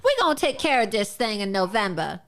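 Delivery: mock her put-on accent for laughs; mocking parody of Kamala Harris. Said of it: "pretending to talk like this".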